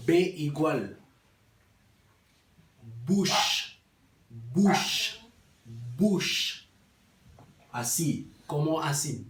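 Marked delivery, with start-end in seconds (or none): 3.1-6.6 "Bush" with an oo vowel and long sh; bouche en criollo. Shown as a demonstration. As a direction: say "Bush" as one syllable, boosh. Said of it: "pa pronunciar". ("Bush" como "buuch", con sh final)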